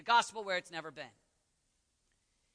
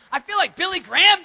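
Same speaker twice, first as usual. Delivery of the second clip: loud, high preacher's shout; real screaming; 9 semitones higher, 14 dB louder than usual. Real, not acted. Preacher enjoying the echoing room, screaming. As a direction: belt it out to fill the hall. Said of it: "I don't usually scream this much".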